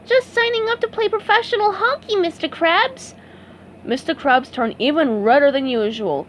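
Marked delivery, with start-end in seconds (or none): 0.1-3.1 high, cheerful sponge voice; proud and happy; beaming. A narrator's reading. SpongeBob telling his boss, beaming high voice. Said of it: "SpongeBob beamed".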